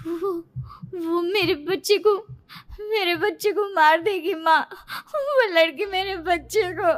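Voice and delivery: high-pitched, frightened stammering to mother; panicked, tearful. Acted. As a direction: plead as if half-awake from a nightmare. Frightened daughter just woken from nightmare.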